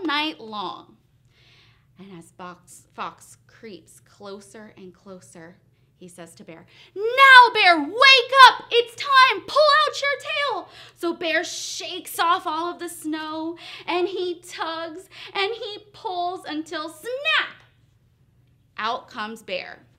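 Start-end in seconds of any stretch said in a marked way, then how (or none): 2.0-6.6 low, soft suspense; hushed narration. A narrator's reading. Storyteller as Fox sneaks up on sleeping Bear, hushed. Narrator creeps in before sudden shout. 6.9-10.7 very loud, high yell; sudden wake-up shout. Acted. Fox rousing sleeping Bear, shouting. 10.9-17.6 high, loud climactic telling; excited narration. A narrator's reading. Storyteller as Bear tugs his tail free, loud and excited.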